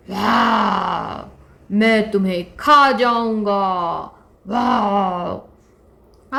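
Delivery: a hungry lion menacing the little deer. Acted. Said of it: "तभी वहाँ पर एक शेर आ गया". (धीमी, ज़ोरदार शेर की आवाज़)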